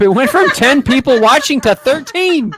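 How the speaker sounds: high, loud burst of laughing excitement; excited. Real, not acted. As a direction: exclaim it like huge news, laughing. Mock-thrilled at tiny audience growth.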